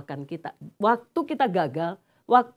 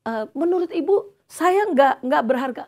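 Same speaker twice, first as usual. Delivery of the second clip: hurt indignant question; 6 semitones higher, 5 dB louder than usual. Retold or imitated. Hurt counselee challenges the speaker.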